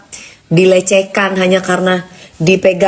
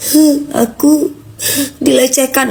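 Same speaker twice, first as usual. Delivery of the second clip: higher-pitched, drawn-out fake sob; mock crying; 5 semitones higher, slower than usual. Singer mimics a crying artist posting about being harassed, mock crying. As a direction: put on a tearful, sniffling complaint. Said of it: "Kamu boleh nangis".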